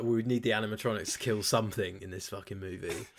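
ordinary delivery

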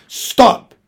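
loud, high-pitched order to halt; sharp command. A narrator's reading. Lady ordering her dwarf to halt, sharp command. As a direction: command the driver to halt at once. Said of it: "said the lady".

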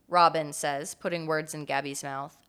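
ordinary talk, nothing marked

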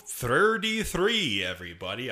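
slight lisp on th sounds; lisping tongue. Real, not acted. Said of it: "little lisp with my tongue since three isn't".

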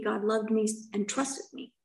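ordinary talk

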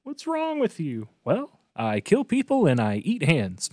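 high, animated cartoon voices; playful put-on character quote; cartoon dialogue impression. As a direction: act out both sides of a cartoon exchange.

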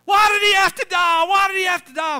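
high, loud shouted lament; mock yelling. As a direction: wail it across the graveyard. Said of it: "he kept yelling".

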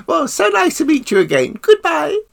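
high, loud, refined royal voice; imitating the Queen. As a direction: put on a gracious queenly voice. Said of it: "And she said".

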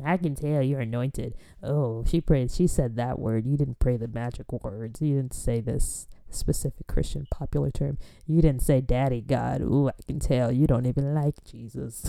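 lightly voiced church critic, low and soft; judgmental churchgoer. Churchgoer judging her prayer, low mock-critical murmur. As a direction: quietly pass judgment in an imitated voice.

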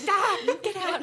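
high, loud, laughing yelp; laughing shoo. Retold or imitated. Telling the therapist to get out of her head, with a high laughing yelp. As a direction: shoo it away through laughter.

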